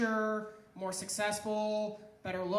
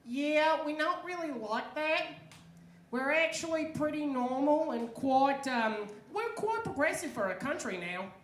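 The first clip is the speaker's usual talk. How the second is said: higher-pitched, louder Australian girl impression; Australian girl accent; 5 semitones higher, 5 dB louder than usual. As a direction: put on an Australian girl's accent. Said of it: "listening to one of their Australian girls talk".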